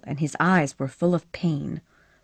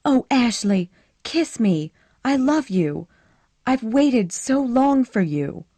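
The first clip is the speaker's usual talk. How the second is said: put-on higher and louder, eager young woman begging; pleading Scarlett; 7 semitones higher, 4 dB louder than usual. A narrator's reading. Scarlett begging Ashley to take her seriously, higher pleading voice. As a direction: push her voice up, urgent and pleading.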